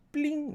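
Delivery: high-pitched imitated "pling"; little high ping sound; high notification pling. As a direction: mimic a chat alert popping up, way up high. Imitates the chat notification popping up.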